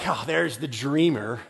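low, slow sneer; mock the little brother's dreams; jealous brothers scoffing at Joseph. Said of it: "they're like".

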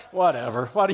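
low, bored "whatever"; mock sulky shrug of a voice; dismissive sister. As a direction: brush it off like a bratty kid sister. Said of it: "my sister came over like".

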